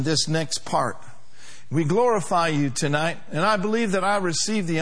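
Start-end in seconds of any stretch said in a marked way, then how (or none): none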